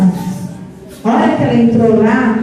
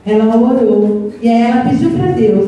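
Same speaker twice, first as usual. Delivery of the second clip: plain reading voice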